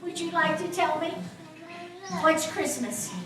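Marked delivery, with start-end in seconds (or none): none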